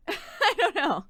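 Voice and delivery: giggling, high; laughing. Real, not acted. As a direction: laugh through the words. Laughing after voice talk.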